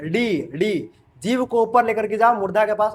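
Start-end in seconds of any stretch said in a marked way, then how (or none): 0.0-0.9 डी with the tongue curled up to the roof; retroflex di. Shown as a demonstration. Teacher modelling डी for students to repeat, retroflex. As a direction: model डी for the class to copy. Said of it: "तुम बोलो".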